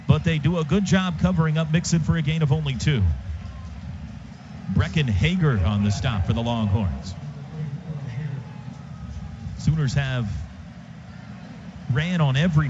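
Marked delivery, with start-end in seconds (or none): none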